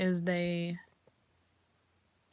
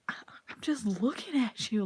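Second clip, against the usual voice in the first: whisper; 3 semitones higher, slower than usual. Retold or imitated. Retort to a stranger's glare.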